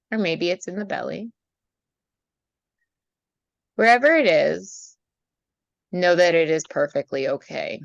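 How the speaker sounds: calm meditation voice with long pauses; guided meditation. Acted. Leads a meditation.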